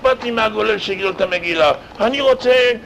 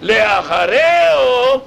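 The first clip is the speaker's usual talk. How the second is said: לאחריהו stretched slow and high, louder; stressed drawn out; 4 semitones higher, 4 dB louder, much slower than usual. Shown as a demonstration. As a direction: draw out לאחריהו slowly. Contrast with לפניהו. (לאחריהו נמשך לאט ובגובה)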